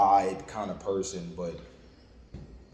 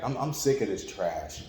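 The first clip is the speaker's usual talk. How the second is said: ordinary delivery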